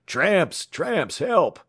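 high, loud cries of alarm; crying out. A narrator's reading. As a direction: yell the alarm for help. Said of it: "he cried".

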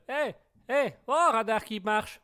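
high, loud, drawn-out mock call; playful calling out. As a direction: call out slowly in mock alarm.

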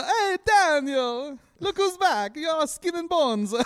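high, slow doting Italian relative; guilt tripping grandma. Retold or imitated. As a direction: fuss over him like a nonna. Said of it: "like, a big Italian family".

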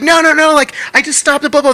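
high, loud frantic pleading; panicked protest. Retold or imitated. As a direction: plead like you're being tackled. Scott panicking during arrest.

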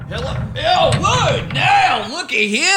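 high, playful stranger voice; hammy arrival greeting; goofy character voice. Acted. Character arrives from time machine.

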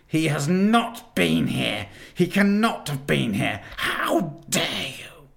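high, loud denial fading to a cold offer; rage, then a menacing bargain; furious witch. A narrator's reading. Witch queen denies Father Christmas came, furious then coaxing. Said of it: "roared the witch".

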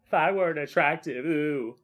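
high, vain self-mocking coo; mock himself as a preening narcissist. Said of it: "Narcissist Prince sitting there saying".

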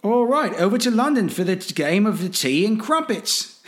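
loud, high-pitched mock London accent; hammed-up cartoon Londoner, voice swooping high; bad British accent. Host hamming up London for the overseas game, bad British accent. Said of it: "How's that for a shitty accent?".

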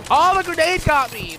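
sudden high loud yelp; startled outburst. Real, not acted. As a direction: caught off guard by the hit. Hit by grenade.

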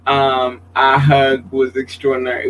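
normal talking voice

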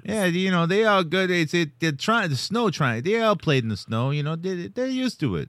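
high-pitched cartoon Canadian accent; put-on Canadian accent. Continues the Canadian bit.

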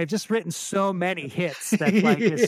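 high, loud fake arrogance; joking to interviewers about his many hits, high and loud mock arrogance; mock boastful. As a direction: brag like a pretend rock star. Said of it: "I know you're just joking around".

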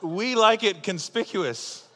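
high, loud, laughing through the words; can barely get it out for laughing. Real, not acted. Preacher tells the congregation the funniest story he knows, laughing.

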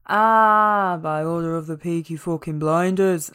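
imitating a Birmingham gangster accent; a passable mock Brummie growl. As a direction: do Tommy Shelby's Brummie line. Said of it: "That is not the best accent".

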